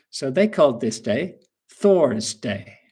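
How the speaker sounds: normal talking voice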